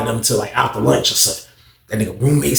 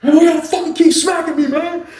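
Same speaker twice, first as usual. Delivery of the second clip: getting smacked; 13 semitones higher than usual. Retold or imitated. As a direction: whine out after being slapped. Beaten snitch protests.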